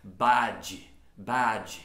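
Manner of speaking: bad with a heavy final D, like "badgee"; over-pronounce the D of bad the Brazilian way; learner's bad with a strong final D; Brazilian-style bad. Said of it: "O brasileiro vê essa letra D e fala".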